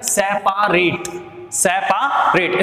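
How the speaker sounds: सेपरेट said slowly, every letter sounded, se-pa-ret; slow spelling pronunciation. Shown as a demonstration. Teacher to students, sounding out सेपरेट slowly. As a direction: drag सेपरेट out syllable by syllable. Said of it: "इसको learn कैसे करना है".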